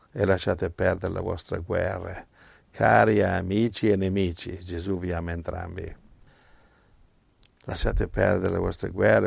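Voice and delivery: usual speaking voice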